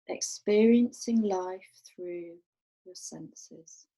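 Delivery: calm, unhurried meditation voice with long pauses; guided meditation. Real, not acted. Teacher guiding a group to rest awareness on the breath, guided meditation. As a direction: guide them gently, letting each phrase settle and fall away.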